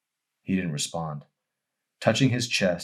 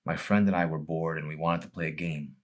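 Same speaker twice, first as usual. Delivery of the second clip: usual speaking voice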